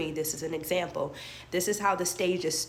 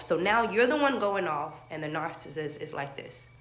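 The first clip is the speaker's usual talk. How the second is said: ordinary talk, nothing marked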